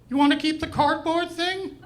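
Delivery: high, puzzled repeat of the request; incredulous cashier. Retold or imitated. Cashier asking the customer in disbelief, high baffled voice. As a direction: echo it back, high and baffled.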